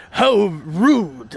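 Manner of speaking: lightly voiced Mafia goon, attempted accent; mock indignant mafia henchman, drawn out; mafia guy imitation. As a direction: imitate a cartoon mobster taking offence. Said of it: "I love how the Mafia guys".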